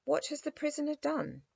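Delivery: high, formal royal question; dignified king. A narrator's reading. The little king questions the captain, trying to sound dignified. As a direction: play a small boy trying to sound regal. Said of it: "in as dignified a manner".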